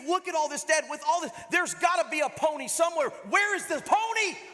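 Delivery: put-on a kid sure a pony is hidden somewhere; eager boy. Boy asking his dad, high and eager.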